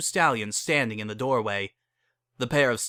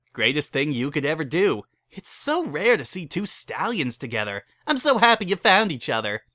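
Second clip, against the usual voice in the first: cheery chapel keeper; 5 semitones higher than usual. Acted. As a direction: sell them a wedding with pushy cheer. Barry gushes over the couple.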